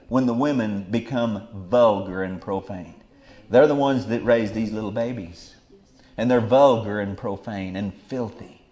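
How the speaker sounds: normal talking voice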